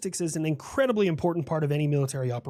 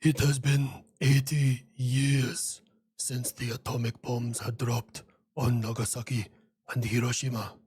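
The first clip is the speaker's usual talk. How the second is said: grave, measured memorial tone; solemn speech; 3 semitones lower than usual. Acted. Solemn remembrance address.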